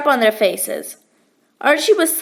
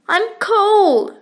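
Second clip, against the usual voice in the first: whimpered; 6 semitones higher than usual. A narrator's reading. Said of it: "she whimpered".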